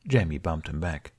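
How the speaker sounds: plain reading voice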